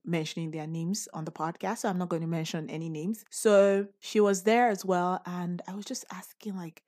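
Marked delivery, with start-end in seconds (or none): 5.2-6.8 whispering. Retold or imitated. Retelling hushed begging.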